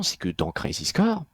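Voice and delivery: ordinary talk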